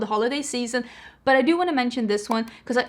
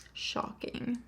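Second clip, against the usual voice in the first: sarcastic whisper; 14 dB quieter than usual. Real, not acted. Sarcastic aside about blush name.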